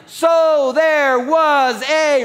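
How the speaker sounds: slow, loud, high word-by-word build; drawn-out reading. Real, not acted. Preacher leading congregation in the verse, slow emphatic reading. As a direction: stretch each word toward the climax.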